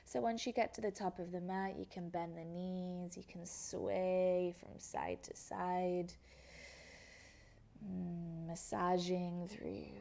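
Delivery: ordinary talk, nothing marked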